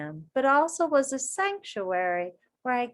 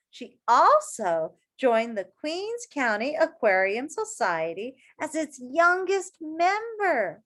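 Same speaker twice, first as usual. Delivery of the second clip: put-on reading to children; 6 semitones higher than usual. A narrator's reading. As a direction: read it to a circle of kids. Reading picture book aloud.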